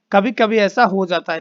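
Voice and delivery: normal talking voice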